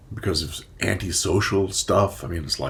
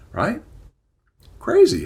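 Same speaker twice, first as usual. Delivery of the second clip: ordinary talk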